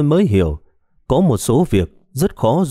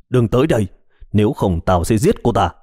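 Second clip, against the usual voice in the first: ordinary delivery, nothing marked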